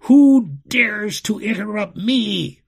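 loud, high, slow imperious demand; booming outrage. A narrator's reading. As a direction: thunder it at whoever dared. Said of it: "His voice boomeranged in the blast room".

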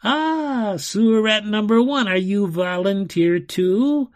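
high, loud, mock-delighted taunt; menacing enchanter. A narrator's reading. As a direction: pounce on your victim with relish.

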